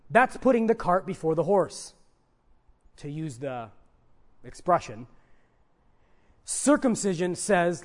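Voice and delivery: ordinary delivery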